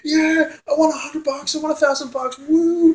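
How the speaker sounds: high, overexcited American contestant; mock American winner. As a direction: hype it up like a game show winner. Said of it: "When you see America".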